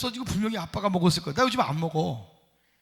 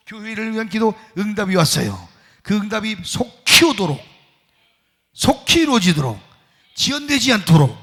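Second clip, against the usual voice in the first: preaching at full force; 9 dB louder than usual. Acted. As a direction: proclaim it over the congregation. Full-force prayer and declaration. (힘차게 선포하는 기도)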